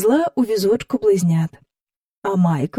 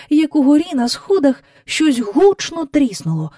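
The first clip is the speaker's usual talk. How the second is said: ordinary delivery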